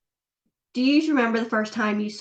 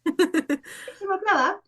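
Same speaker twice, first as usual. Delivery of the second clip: "Peak summer blowout" in a failed mock accent, higher; 8 semitones higher than usual. Shown as a demonstration. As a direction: try the accent and botch "blowout". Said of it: "I can't do the accent".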